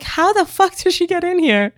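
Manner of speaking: high mock disbelief from the Juilliard kids; shocked classmates.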